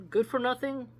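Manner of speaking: intrigued query; curious question. Real, not acted. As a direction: quiz the phrase out loud. Questioning the quoted phrase.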